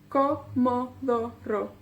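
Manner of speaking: Comodoro slowly split as co, mo, do, ro; syllable by syllable. Shown as a demonstration. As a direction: count out each syllable of Comodoro. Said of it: "uno con más sílabas".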